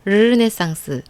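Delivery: 르네상스 slow, tongue brushing the palate on 르, no trill; retroflex 르네상스. Shown as a demonstration. Teacher demonstrating the correct 르 in 르네상스. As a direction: stretch 르네상스 out, 르 curled not rolled. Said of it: "혀가 입 천장 안쪽을 살짝 스치듯이".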